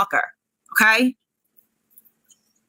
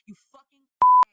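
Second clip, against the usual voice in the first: furious insult; 4 dB louder than usual. Real, not acted. Ranting streamer hurling insult at Nick on stream. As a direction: pitch leaps high on the slur, louder.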